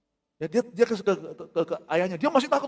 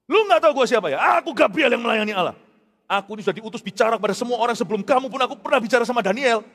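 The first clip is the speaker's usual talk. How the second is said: loud, high, proud rebuke; offended angel; 5 semitones higher, 6 dB louder than usual. Acted. Gabriel boasting his rank to Zakaria, loud indignant rebuke. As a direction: throw your rank at him, loud and high. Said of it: "Malaikat itu masih ada egonya".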